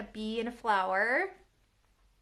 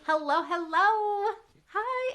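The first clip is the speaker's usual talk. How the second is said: cooing at dog; 7 semitones higher, slower than usual. Real, not acted. Owner greets her dog Lady, cooing. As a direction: coo lovingly to the dog.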